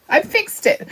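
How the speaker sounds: chirpy high-pitched I fixed it; proud little cheer. Real, not acted. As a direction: pitch jumps way up on I, pleased with herself. Proud repeat after fixing a spot.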